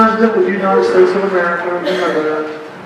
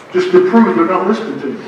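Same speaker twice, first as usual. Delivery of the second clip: ordinary talk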